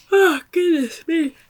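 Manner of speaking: talking through a yawn, high and slow. Real, not acted. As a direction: let a big yawn stretch the words. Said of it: "Why am I yawning so much today?".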